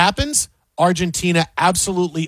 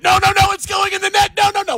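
high, loud fan's panicked pleading; mock panic; 13 semitones higher, 3 dB louder, faster than usual. Host plays a fan dreading the goal, high mock panic. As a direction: plead frantically up high, hammed-up.

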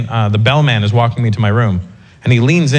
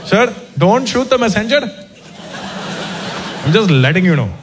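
Indian bellman; 7 semitones higher than usual. Retold or imitated. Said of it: "and he goes".

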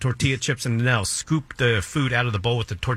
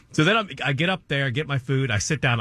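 usual speaking voice

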